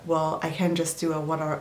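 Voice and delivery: ordinary talk, nothing marked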